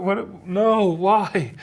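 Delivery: high-pitched mock panic; retold freak-out. Man replaying the shower news, mock freaking out. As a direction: plead in exaggerated, comic disbelief. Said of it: "freaking out".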